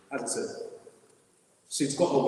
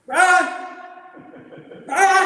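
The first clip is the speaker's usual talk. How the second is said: imitating mum yelling his name, high and much louder; mum calling out; 12 dB louder than usual. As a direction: bellow the name like a mother calling across the house. Mum calling him from her room.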